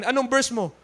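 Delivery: normal talking voice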